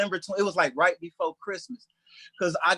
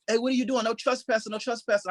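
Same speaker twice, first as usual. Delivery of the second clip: higher-pitched warning to an intruder; 7 semitones higher than usual. Retold or imitated. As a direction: imitate a homeowner challenging someone over the intercom. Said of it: "on his intercom, he started talking".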